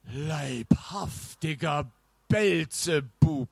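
very slow, drawn-out horrified exclamation; aghast knight. Acted. Knight sees a car roaring towards him, slow and aghast. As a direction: stretch each word in stunned dread.

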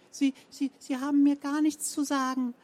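timid protest. A narrator's reading. Fräulein Rabenhorst stammers back at Frau Zenker, high and timid. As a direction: protest but barely believe it. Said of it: "Es klang, als könnte sie es selbst nicht glauben".

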